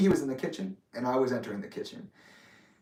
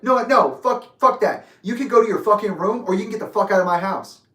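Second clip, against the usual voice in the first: imitating a furious father, loud and high; retold angry ultimatum; going off; 7 semitones higher, 7 dB louder than usual. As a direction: order them out with a raised voice. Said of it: "Just starts going off on me".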